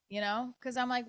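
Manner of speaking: normal talking voice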